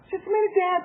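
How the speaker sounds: high-pitched mock child voice; stalling kid. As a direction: imitate a child stalling from behind a door. Child stalling behind the door.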